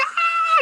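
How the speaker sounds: high, loud mock shriek; scared scream. Showing how the badass hero finally loses his cool, scared scream. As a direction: burst into a comic yelp of panic. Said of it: "And gets scared".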